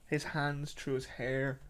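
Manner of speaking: usual speaking voice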